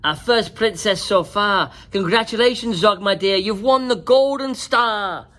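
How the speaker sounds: loud, high proud announcement; teacher dragon congratulating Zog. A narrator's reading. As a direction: announce it to the whole class. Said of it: "said Madam Dragon".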